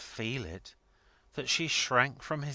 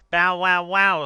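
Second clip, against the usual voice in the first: loud derisive bow-wows; scornful dog imitation, at full volume; mocking dog bark; 10 semitones higher, 14 dB louder than usual. A narrator's reading. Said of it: "says the dog".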